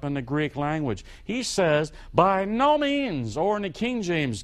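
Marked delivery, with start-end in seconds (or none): 2.1-3.4 slow, weighty and higher; emphatic no. Retold or imitated. Preacher quoting Paul's firm refusal, slow and weighty. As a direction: drive home a firm refusal. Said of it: "the most emphatic expression of no".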